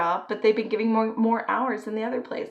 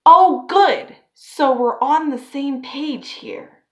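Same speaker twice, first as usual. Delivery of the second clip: high, loud "oh, good", then a slow drawl; mocking the boss; 5 semitones higher, 10 dB louder, much slower than usual. Mimicking the manager glad she's quitting, high then drawn out. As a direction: sneer the boss's relief back at her. Boss relieved she quits.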